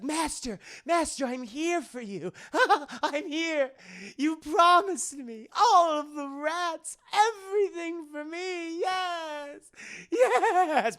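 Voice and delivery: high, wailing servant with manic laughs; hammed-up sobbing devotion, drawn out; crying, grovelling Renfield. Renfield begging his master for the rats, crying and cackling. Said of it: "He's crying at the same time".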